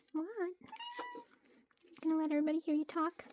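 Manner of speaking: high, slow pet-talk tease; teasing the cat. Real, not acted.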